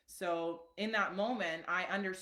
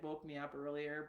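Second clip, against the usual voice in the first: ordinary talk, nothing marked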